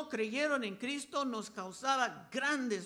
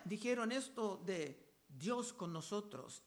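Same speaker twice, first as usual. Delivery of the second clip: usual speaking voice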